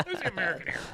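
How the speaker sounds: high-pitched mock indignation; mimicked indignant retort. Podcaster repeats Peggy's retort to the kid, high mimicry. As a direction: imitate her high, huffy comeback. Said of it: "just like that".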